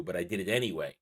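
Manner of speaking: ordinary talk, nothing marked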